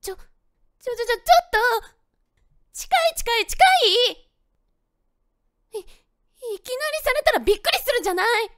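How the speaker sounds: fast, high, loud flustered protest; flustered panic. Acted. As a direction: sputter in a panic, too close. He suddenly pins her to wall.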